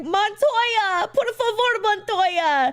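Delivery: high, pleading mock cry of a name; imitating the Montoya scream. Mock wail of a jilted man calling his girlfriend's name on the beach. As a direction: wail it like a heartbroken guy on a beach. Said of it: "the guy where it's like".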